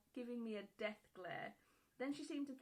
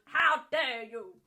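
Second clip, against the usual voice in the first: loud slow indignant yell; 15 dB louder, much slower than usual. Retold or imitated. Mother, slapped back, yelling at her son in outrage. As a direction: belt out the outrage. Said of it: "then yelled".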